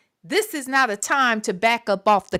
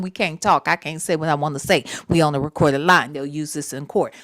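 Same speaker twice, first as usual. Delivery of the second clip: mocking the boyfriend; 10 semitones lower than usual. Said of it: "is by saying".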